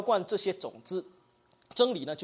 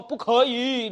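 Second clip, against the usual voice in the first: objecting parent; 4 semitones higher, 8 dB louder, much slower than usual. Acted. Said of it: "有些父母亲他会说什么".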